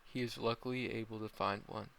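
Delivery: ordinary delivery, nothing marked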